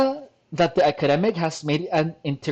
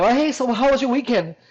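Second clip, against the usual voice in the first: lightly voiced, higher breezy greeting; a hint of a chatty friend, pitched up; drag queen's friend; 7 semitones higher than usual. Drag friend catching up after the weekend, lightly acted. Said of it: "your drag friends being like".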